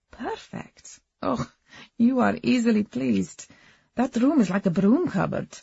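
put-on lilting Eastern European accent. A narrator's reading. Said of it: "held an Eastern European lilt".

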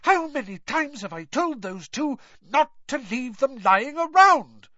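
high scolding building to a loud peak; exasperated, high-pitched yell; angry shout. A narrator's reading. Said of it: "she shouted".

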